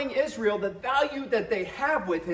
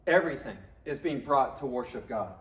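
ordinary delivery

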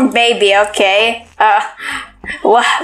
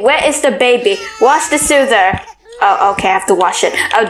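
normal talking voice

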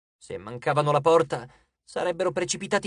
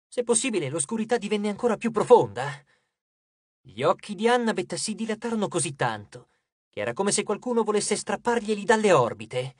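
plain reading voice

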